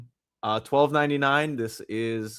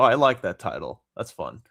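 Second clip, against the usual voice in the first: normal talking voice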